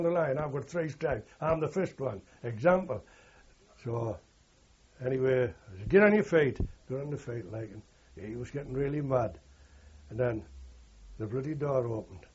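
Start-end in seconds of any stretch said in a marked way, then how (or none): 5.9-6.6 loud barked order; sergeant's order. Retold or imitated. Sergeant orders his man up, loud command. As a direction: snap the lad up to his feet.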